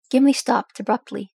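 ordinary delivery

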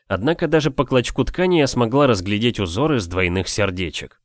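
plain reading voice

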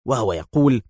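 ordinary delivery, nothing marked

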